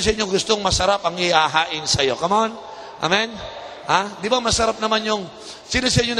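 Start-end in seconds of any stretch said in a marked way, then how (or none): none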